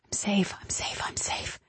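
barely voiced, fading whisper. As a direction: whisper it to yourself, dying away. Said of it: "I tell myself".

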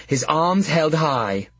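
plain reading voice

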